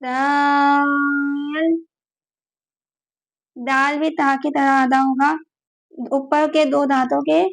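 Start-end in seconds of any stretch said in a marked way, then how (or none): 0.0-1.8 دال with the long a held very long; stretched daal. Shown as a demonstration. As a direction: draw out دال for five counts. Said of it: "اس کو بھی پانچ الف کھینچیں گے".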